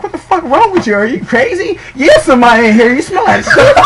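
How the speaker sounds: high-pitched comic outrage with laughter; mock outraged disbelief. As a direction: confront him in squealing, laughing disbelief. Acted confrontation in the bathroom.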